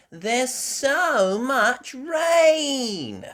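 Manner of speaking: high, slow, loud little piglet voice; Peppa voice. A narrator's reading. Peppa to her parents, high little-girl voice. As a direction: stretch it out like an excited little girl. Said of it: "Peppa said".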